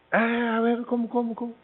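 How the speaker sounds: high, cocky mock disbelief; arrogant. Villa scoffs at the doctor's rejection, arrogant and high. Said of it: "con su arrogancia".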